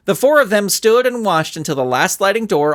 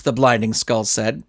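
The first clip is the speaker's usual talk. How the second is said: ordinary delivery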